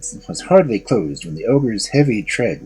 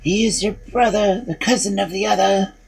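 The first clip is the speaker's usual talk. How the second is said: small lamb voice; 8 semitones higher than usual. A narrator's reading. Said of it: "the lamb's flesh answered".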